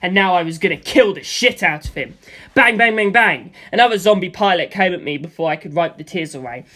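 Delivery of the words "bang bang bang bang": loud punchy bang bang; mock gun sounds, loud; voiced gunfire. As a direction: spit out the shots like gunfire.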